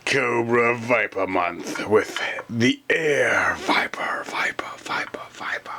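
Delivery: drawn-out show title fading into whispered echoes; announcer echo. Pretend. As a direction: announce it slowly, then echo the last word away. Show intro, title repeated as echo.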